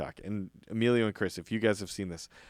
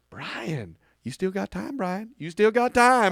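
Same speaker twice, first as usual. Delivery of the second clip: teasing reassurance; 7 semitones higher, slower than usual. Retold or imitated. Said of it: "he'll go".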